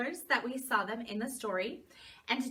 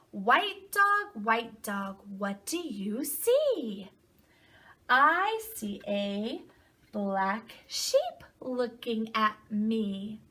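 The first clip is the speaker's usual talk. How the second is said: slow, lilting read-aloud with stretched words; storybook reading to children; 4 dB louder, much slower than usual. A narrator's reading. Teacher reading a picture book to children, slow singsong storytelling. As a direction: read slowly, swooping up on key animal names.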